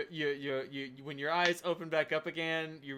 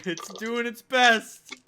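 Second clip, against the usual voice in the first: encouraging; 5 semitones higher, 10 dB louder, slower than usual. Acted. Cheering on a struggling creature.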